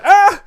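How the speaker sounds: high, loud startled "ah"; mock yelp. Host handling game pieces like hot potatoes, mock yelp. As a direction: yelp as if burnt.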